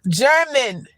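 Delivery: usual speaking voice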